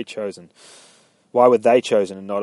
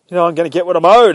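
raised, quick argumentative retelling; heated retort; 6 semitones higher, 10 dB louder, faster than usual. Retold or imitated. Man in a bit of a barney, heated. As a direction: act out an indignant demand, higher and louder.

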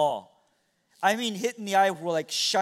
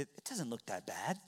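soft reassurance; 9 semitones lower, 14 dB quieter than usual. Retold or imitated. Father comforting his hurt son.

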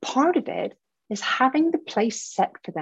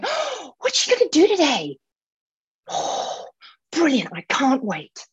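a much higher, gushing, excited fan voice; eager fan; 5 semitones higher than usual. Pretend. Coach voices imagined fans eager for her post, gushing. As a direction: squeal like a delighted follower. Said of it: "They're there going".

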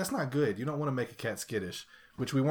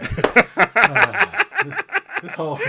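much louder, laughing through it; 11 semitones higher, 16 dB louder than usual. Real, not acted. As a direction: crack up at his own joke. Joking about himself.

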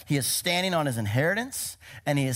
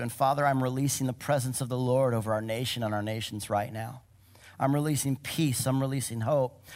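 hushed personal prayer; 3 semitones lower than usual. Shown as a demonstration. Prayer quoted softly.

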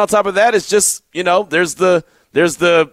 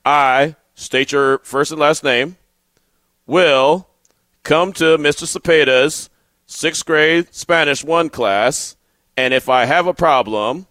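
slow, low teacher dictation with pauses; Mister Cepeda, all slow; 5 semitones lower, slower than usual. Retold or imitated. Host imitates his teacher's pledge for the class, slow and low. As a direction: dictate phrase by phrase, deliberately and sternly. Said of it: "he's doing this all slow".

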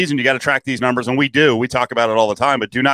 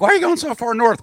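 puzzled quote; 12 semitones higher than usual. Retold or imitated. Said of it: "I'm like".

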